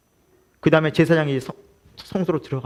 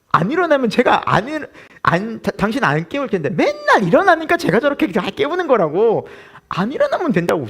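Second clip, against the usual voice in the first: mock scolding wife; 7 semitones higher than usual. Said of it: "저희 아내는 그래요".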